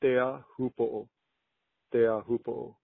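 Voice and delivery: Teahupo'o as tay-ah-hoo-po-oh; careful native Teahupo'o; proper pronunciation. Shown as a demonstration. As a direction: say Teahupo'o slowly, ending on a long double o. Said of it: "The proper way to say it is".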